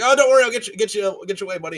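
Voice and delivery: high, loud, panicked reassurance; acted frantic comforting, starting at a peak; really scared dad. Scared dad squeezing his kid, panicky. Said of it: "the dad's really scared".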